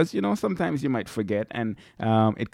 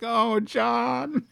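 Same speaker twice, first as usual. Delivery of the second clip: mock embarrassed wife, higher with a laugh; 9 semitones higher than usual. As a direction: play the wife hiding her face, laughing. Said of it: "she's probably covering her face like".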